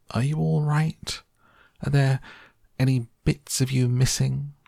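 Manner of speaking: high-pitched worried questioning; anxious cry. A narrator's reading. Mother checking her son is whole, anxious cry. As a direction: ask in a high, fretful cry. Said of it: "cried Missus T V".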